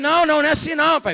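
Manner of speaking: high, loud, panicked protest; pleading teen. Retold or imitated. Teenage son pleading with his angry father, high and loud. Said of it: "Aí eu falei".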